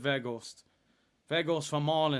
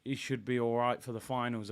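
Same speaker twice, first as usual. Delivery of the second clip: ordinary talk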